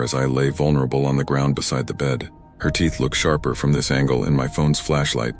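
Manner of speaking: usual speaking voice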